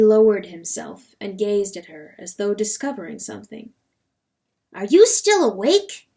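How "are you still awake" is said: high, loud, startled question; surprised. A narrator's reading. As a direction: ask it loudly, pitch jumping up. Rooster startled by wakeful wife.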